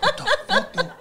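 very high startled cry; shocked. Retold or imitated. At the naked bodyguard, a high shocked yelp. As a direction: yelp it in disbelief.

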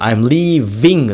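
very slow "leaving" with a clear buzzing V; emphasized V. Shown as a demonstration. Coach pointing out the V, "leaving" drawn out with a buzzed V. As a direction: stretch the V in "leaving". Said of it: "Vの音がすごい聞こえます".

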